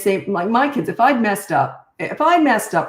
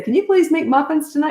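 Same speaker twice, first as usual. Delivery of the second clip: put-on higher, pleading kid's voice; eager kids' request; 9 semitones higher, 3 dB louder than usual. Kids begging mom to bake muffins, higher pleading voice. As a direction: ask sweetly like a little kid.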